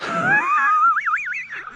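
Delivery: a very high squealing laugh of relief. Real, not acted. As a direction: burst into a high-pitched laugh. Fish lost, relieved laughter.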